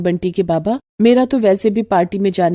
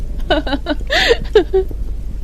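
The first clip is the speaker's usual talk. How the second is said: high scornful laugh at her poor sister; mocking laugh; 12 semitones higher than usual. Rich Alpana laughing at Kalpana, high mocking laugh. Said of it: "Alpana जोर जोर से हंसने लगती है".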